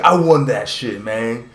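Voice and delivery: imitated fighter in an American accent, drawn out. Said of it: "an American accent".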